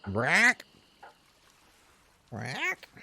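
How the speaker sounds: squawking parrot call. Pretend. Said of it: "My, my little parrot impression".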